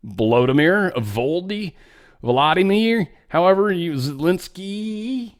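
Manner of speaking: drawn-out, rising mock attempts; mocking name-fumbling. Commentator fumbling a leader's name on purpose, mocking uncertainty. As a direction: stretch each name upward, as if mockingly unsure.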